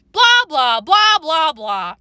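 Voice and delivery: loud, high singsong jeer falling off at the end; mocking shout. A narrator's reading. Girls laugh at the big sisters, mocking shout. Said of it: "as we shouted".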